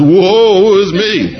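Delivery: very slow, high, laughing woe; hammed-up despair, drawn out and laughing; mock lament. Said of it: "he says".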